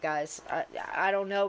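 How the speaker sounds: ordinary delivery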